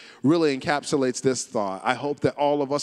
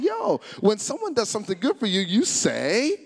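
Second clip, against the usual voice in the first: mock-scolding prompt, pitched up; mildly mad; 7 semitones higher than usual. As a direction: retell a high-pitched telling-off. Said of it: "I was mad at you".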